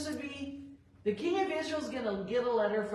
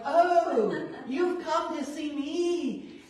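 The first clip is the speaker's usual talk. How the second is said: high, loud "Oh" with a laugh, then slow and sugary; mock-gushing host, laughing and drawn out; gushing welcome; 5 semitones higher, 6 dB louder, much slower than usual. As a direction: fawn over the guest, overdoing the delight. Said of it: "oh, gushing".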